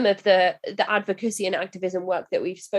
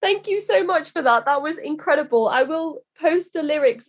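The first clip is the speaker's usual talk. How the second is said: excited thanks; 7 semitones higher than usual. Real, not acted. Host thanks the guest after the verse.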